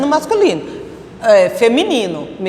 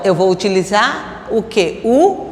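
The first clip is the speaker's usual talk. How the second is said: ordinary delivery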